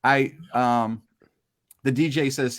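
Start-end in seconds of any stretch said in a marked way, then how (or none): none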